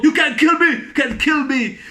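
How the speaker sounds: high, loud acted dare; hammed-up defiant movie line; mock defiant shout. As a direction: dare him like a movie villain. Acted defiant film quote.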